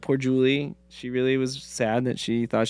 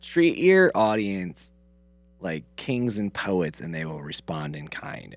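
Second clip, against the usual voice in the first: slow, lowered, measured recital; deliberate quote, getting softer; reciting a quotation; 6 semitones lower, 4 dB quieter, slower than usual. Retold or imitated. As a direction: deliver it as a weighty maxim, sinking in pitch.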